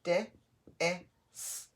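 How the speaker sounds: "Di-, is." as separate sounds of dis, long pauses between; sounding out. Shown as a demonstration. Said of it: "The first syllable is dis".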